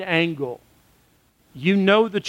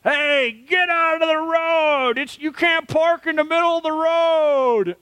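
put-on drunken heckler's yell; 10 semitones higher than usual. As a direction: imitate drunk hecklers yelling, vowels stretched long. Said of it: "yell at us while they're drunk".

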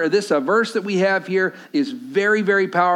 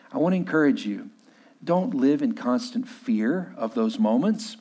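softer, lower pastoral appeal; earnest sermon appeal; 5 semitones lower, 3 dB quieter than usual. Real, not acted. Pastor to congregation about fear, softer, lower and earnest. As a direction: quietly urge the congregation not to fear.

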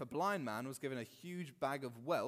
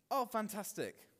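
higher-pitched mock delight; mock gushing; 7 semitones higher, 3 dB louder than usual. Crowd changing its tune at the end, mock gushing. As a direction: briefly imitate an over-keen fan.

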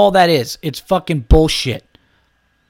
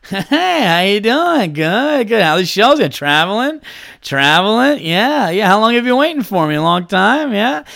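breezy doctor; 4 semitones higher, 4 dB louder than usual. Retold or imitated. Doctor's breezy greeting imitated.

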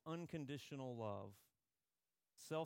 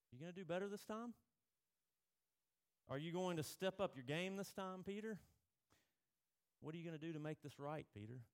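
higher, fast, pushy questioning; demanding challenge; 5 semitones higher, faster than usual. Retold or imitated. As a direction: press him like a coach demanding more. Jesus voiced challenging Peter.

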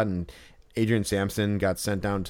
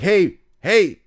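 rising, ever higher yell, losing patience; impatient yell; 9 semitones higher, 6 dB louder, slower than usual. Retold or imitated. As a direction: call louder and higher each time. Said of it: "if you yell it enough times".

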